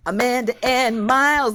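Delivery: ordinary talk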